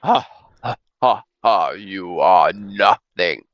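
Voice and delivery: slow mocking dragon laugh. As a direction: laugh down at them, then sneer. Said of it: "You see the dragon laugh".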